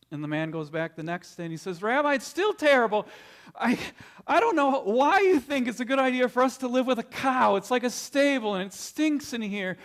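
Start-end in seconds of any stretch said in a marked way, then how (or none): 1.8-9.8 louder, higher exasperated complaint; desperate father. Acted. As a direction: beg the rabbi for help, at wit's end.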